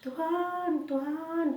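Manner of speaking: high, loud cry to God; re-enacted frightened shouting. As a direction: call out in fear for the Lord. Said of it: "saya berteriak-teriak".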